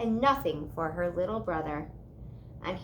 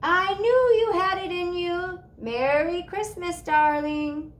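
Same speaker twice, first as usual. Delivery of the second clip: far-off call; 9 semitones higher, 6 dB louder than usual. A narrator's reading. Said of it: "barely hear her aunt's voice over the wind".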